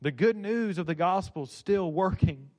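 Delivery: ordinary delivery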